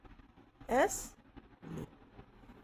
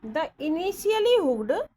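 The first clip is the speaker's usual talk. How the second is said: initially drawn out slowly, higher and louder; slow dictation; 6 semitones higher, 6 dB louder, much slower than usual. Shown as a demonstration. Teacher dictates a rule to students, slow and loud. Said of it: "शब्द होते हैं जो".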